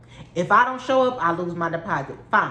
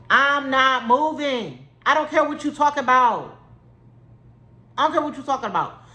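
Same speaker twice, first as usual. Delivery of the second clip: defiant refusal; 5 semitones higher than usual. Retold or imitated.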